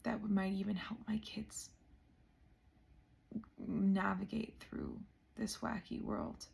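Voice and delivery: overwhelmed, barely voicing it; choked-up whisper. Real, not acted. Vlogger moved to tears by her own journey, whispering. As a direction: voice dropping to a whisper from emotion. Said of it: "the gravity of this is hitting me".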